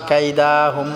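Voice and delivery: কাইদাহুম with the দা drawn out; learner's error, long দা in কাইদাহুম; wrongly stretched vowel. Shown as a demonstration. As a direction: drag the middle a of কাইদাহুম. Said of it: "টান করি".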